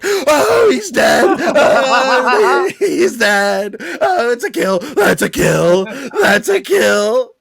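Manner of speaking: high, loud gleeful whooping with laughter; rowdy cheering. Retold or imitated. Jays fans standing up in the stands, loud gleeful cheering. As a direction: cheer like a fan who just saw a big hit. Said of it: "We stood up and we're like".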